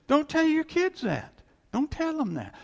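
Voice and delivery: loud high warning; emphatic warning. Real, not acted. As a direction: insist hard, way up.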